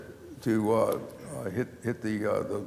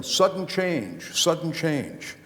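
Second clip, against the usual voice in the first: slow drawn-out called chant, pitched higher; mock yelling; 6 semitones higher, much slower than usual. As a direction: call it out like players on the field. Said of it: "everybody's yelling".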